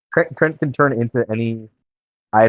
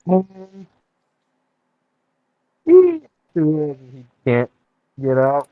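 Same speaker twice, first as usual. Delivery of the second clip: muffled, like through a pillow; speaking through a pillow; 3 semitones higher than usual. Real, not acted. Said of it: "Sounds like you're inside of a pillow right now".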